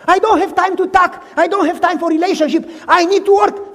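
too busy to stop; rushed and insistent; frantic refusal. Acted.

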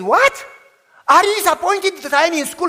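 can't believe what dad just said; shocked disbelief. Retold or imitated. Shocked son retorts to dad.

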